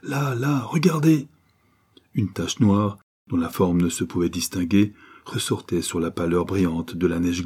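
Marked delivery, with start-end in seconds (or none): 0.0-1.3 high, urgent call; crying out. A narrator's reading. Father pointing toward the middle of the river, crying out. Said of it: "en criant".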